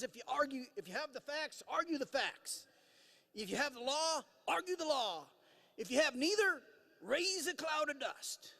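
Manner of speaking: a folksy Southern dean, drawn out; mock Southern drawl, high and slow at the end. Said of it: "in his best Southern drawl, which I'll attempt to imitate".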